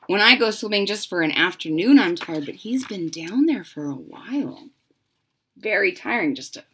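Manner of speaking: plain reading voice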